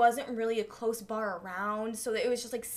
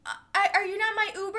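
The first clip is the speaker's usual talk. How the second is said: higher, fast and flustered; confused drunk girl; 9 semitones higher, 5 dB louder, much faster than usual. Retold or imitated. Drunk girl at my car door asking if I'm her Uber, flustered. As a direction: blurt it out like a lost drunk stranger. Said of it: "And she's like".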